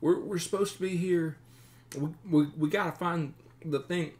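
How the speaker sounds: high-pitched, stammering simpleton voice; nervous captive bandit. Acted. Captive bandit explains himself to his captors, high and halting. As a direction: stumble anxiously through an explanation.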